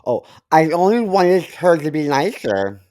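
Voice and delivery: ordinary talk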